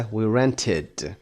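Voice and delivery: "rented" with an extra id syllable. Shown as a demonstration. Teacher giving an example, "rented" ending in a full id. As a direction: slowly sound out "rented" as rent-id.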